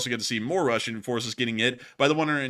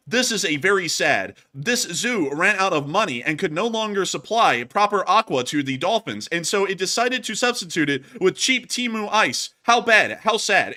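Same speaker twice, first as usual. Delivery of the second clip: high, loud, cheery AI voiceover; TikTok AI voice; 5 semitones higher, 4 dB louder than usual. Retold or imitated. Streamer imitating a Chinese TikTok voiceover, high and bright. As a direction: chirp it like a cheap TikTok narrator. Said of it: "It was a Chinese TikTok thing".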